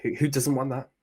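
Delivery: unintentionally sarcastic-sounding agreement; sounds sarcastic. Real, not acted. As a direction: agree eagerly, landing almost like sarcasm. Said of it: "sorry, that sounds sarcastic".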